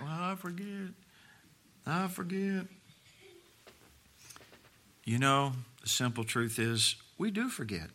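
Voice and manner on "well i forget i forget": put-on small, soft, higher-pitched kid voice; sheepish child. Preacher playing a kid answering mom, sheepish and small. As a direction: make a meek little excuse as a child would.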